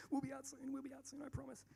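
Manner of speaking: high, soft placating murmur; whisper-promise to calm her sister; on the phone to her sister, softly placating; hushed pleading. Retold or imitated. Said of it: "just going".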